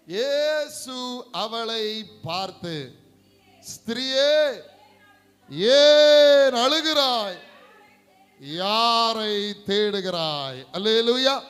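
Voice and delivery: very loud, high, drawn-out preaching cry; scripture proclamation. Real, not acted. Preacher cries Jesus' question to the congregation, loud proclamation. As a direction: proclaim the verse at full force, slowly.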